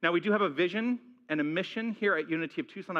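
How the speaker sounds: ordinary talk, nothing marked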